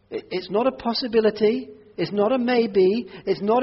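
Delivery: usual speaking voice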